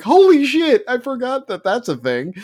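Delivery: loud, high shocked outburst. Retold or imitated. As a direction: blurt it out in shock. Retelling shock at news.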